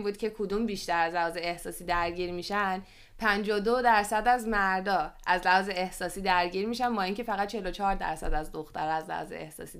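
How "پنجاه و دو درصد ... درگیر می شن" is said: usual speaking voice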